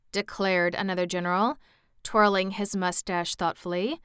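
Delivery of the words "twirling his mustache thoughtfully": plain reading voice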